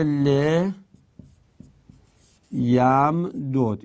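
ل یم دود with both dals apart, the last one vowelless; slow, drawn-out ل یم دود ending on a still d; unmerged form. Shown as a demonstration. Teacher shows students the first case, unmerged form. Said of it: "این یه حالت".

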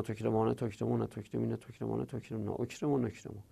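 fast drill, voice sinking away through the list; rapid conjugation. Real, not acted. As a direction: rattle off the verb table by rote.

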